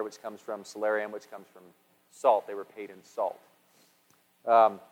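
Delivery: ordinary talk, nothing marked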